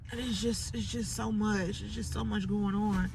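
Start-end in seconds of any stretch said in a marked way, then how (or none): none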